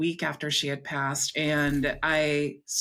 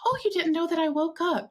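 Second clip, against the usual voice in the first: much higher, lilting retold voice; imitated mother; 11 semitones higher than usual. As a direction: play a mother gently surprised, pitched high. Voices her mother in dream.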